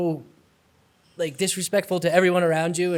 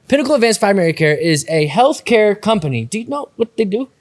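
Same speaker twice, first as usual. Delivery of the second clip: upbeat, louder sponsor read; 5 semitones higher, 7 dB louder than usual. Acted. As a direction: sell it like a radio ad. Reads sponsor ad.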